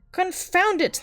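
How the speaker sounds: sharp, loud and high curse; angry outburst. Acted. Little Klaus storming at the innkeeper, angry shout. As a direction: blurt it out in outrage. Grandmother knocked over, Klaus bursts out.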